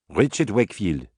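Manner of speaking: plain reading voice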